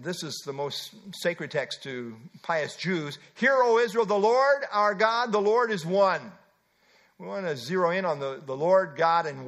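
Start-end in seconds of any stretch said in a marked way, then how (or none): 3.3-6.3 solemn recitation. Real, not acted. Reciting the most sacred text.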